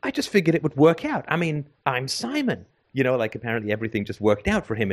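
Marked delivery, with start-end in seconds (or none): 0.0-2.7 higher, grinning carefree shrug of a voice; a hint of cocky self-satisfaction; smug grin. Simon grinning at the friend who questions him, lightly smug. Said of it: "looked at me with a big grin".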